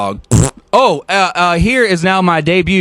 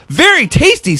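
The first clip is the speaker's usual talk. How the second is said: higher, smug little dog voice; pleased, higher dog voice; 10 semitones higher than usual. Acted. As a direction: Walter savouring his treat to himself. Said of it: "said Walter to himself".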